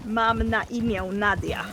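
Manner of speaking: "Mam na imię Nadia" again, slow and deliberate; Polish demo. Shown as a demonstration. Teaching friends to repeat the Polish phrase, slowly.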